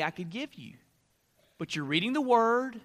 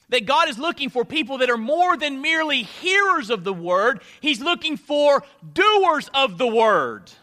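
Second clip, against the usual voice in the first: loud, raised pulpit voice building to a peak; full-force preaching; 6 semitones higher, 6 dB louder than usual. Real, not acted. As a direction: preach it out to the whole congregation.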